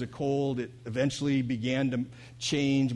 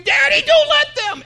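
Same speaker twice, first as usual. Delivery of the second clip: shrieking high imitation of a terrified toddler; toddler's scream; 14 dB louder than usual. Toddler pleading with daddy at the vaccination, imitated scream. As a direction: scream it like a child begging daddy, far up in pitch. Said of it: "they begin to scream".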